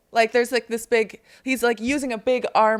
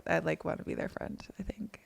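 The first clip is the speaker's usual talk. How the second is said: whisper; 2 semitones lower, 9 dB quieter than usual. Real, not acted. Confiding she wants to be friends.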